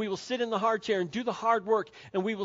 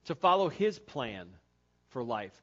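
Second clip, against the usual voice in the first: normal talking voice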